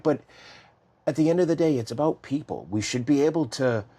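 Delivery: ordinary talk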